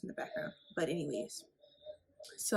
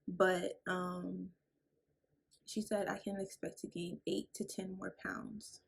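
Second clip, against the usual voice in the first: ordinary talk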